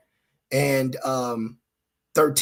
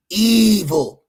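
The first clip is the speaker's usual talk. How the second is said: emphatic evil; 5 semitones higher, 7 dB louder than usual. Real, not acted. Preacher denouncing evil.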